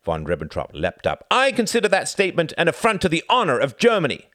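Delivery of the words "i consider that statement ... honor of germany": higher, louder and fast indignation; affronted outburst. A narrator's reading. Envoy leaping up insulted, loud and fast. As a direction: bristle at the insult.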